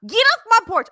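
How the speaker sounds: high, loud angry yell; shouting at trespasser. Acted. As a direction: chase the pollster off. Replays the resident's yell.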